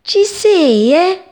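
cat cries out catching the bird; pouncing cat shout. Acted. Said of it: "gridò".

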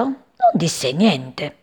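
usual speaking voice

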